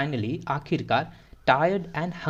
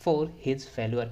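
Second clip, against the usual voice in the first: slow read-aloud; much slower than usual. Shown as a demonstration. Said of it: "teacher reads slowly".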